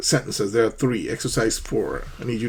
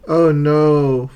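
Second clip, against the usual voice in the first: disappointed oh no; 4 semitones higher, 7 dB louder than usual. Shown as a demonstration. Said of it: "for disappointment".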